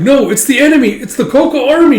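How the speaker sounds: high, loud alarmed cry; panicked soldier. Acted. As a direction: shout the warning in fright.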